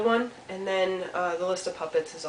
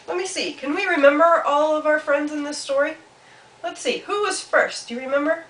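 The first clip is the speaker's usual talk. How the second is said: high, lilting questions; playful quizzing; 7 semitones higher, 5 dB louder than usual. Real, not acted. Storyteller quizzes children on the friends, high and playful. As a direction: invite little ones to remember.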